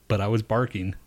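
ordinary talk, nothing marked

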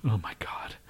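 whispered. Real, not acted. Dismay at the money offer.

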